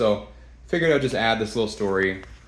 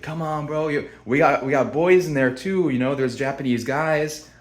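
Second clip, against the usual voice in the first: imitated tout, higher-pitched; mock pushy sales pitch; coaxing tout; 7 semitones higher, 4 dB louder than usual. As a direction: coax like a street hustler, voice raised. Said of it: "He's like".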